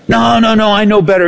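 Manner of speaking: high, mock-insistent child voice; a five-year-old sure he knows best; stubborn kid. Five-year-old arguing with a parent, high and insistent.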